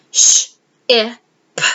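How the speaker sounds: sh, I, p said one at a time; isolated sounds. Shown as a demonstration. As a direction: sound out sh, I, p separately. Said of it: "blend the sounds".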